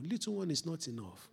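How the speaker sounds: ordinary talk, nothing marked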